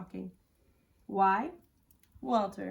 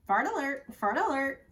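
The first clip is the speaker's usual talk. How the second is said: squawking parrot; 5 semitones higher, 4 dB louder than usual. A narrator's reading. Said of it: "squawked the parrot".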